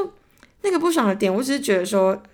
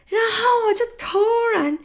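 a sudden high, crying wail of disbelief; shocked wail; 13 semitones higher, 4 dB louder than usual. Retold or imitated. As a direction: let out a stunned, half-crying cry. Dentist cancels her appointment unannounced. (傻眼时突然带哭腔地高声惊叫)

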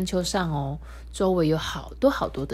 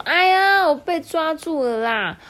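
much higher, loud whiny protest; sulky complaint; 10 semitones higher, 5 dB louder than usual. Acted. Mimi grumbling after being tagged, whiny. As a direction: complain about being caught. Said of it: "咪咪不甘心地说".

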